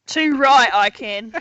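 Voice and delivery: hint of an Aussie accent, loud; mock Australian accent. Player agreeing to speak Australian to the party, a hint of an Australian accent. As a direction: answer like a cheeky Aussie. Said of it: "speak in an Australian accent".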